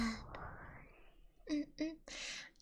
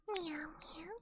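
meow; 3 semitones higher, 7 dB quieter than usual. Pretend. Cat girl streamer meowing.

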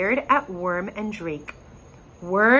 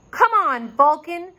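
high eager urging; 8 semitones higher, 4 dB louder than usual. A narrator's reading. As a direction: urge him on, pitched up. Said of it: "Rory urged him".

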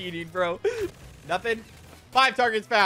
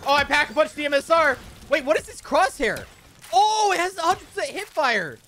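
high, loud gamer hype; real thrilled outburst; excited yelling; 6 semitones higher, 6 dB louder than usual. Real, not acted. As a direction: exclaim loudly in amazed delight. Delighted by new weapon.

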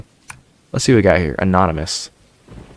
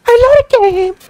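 very high squeaky falsetto; 6 dB louder than usual. Pretend.

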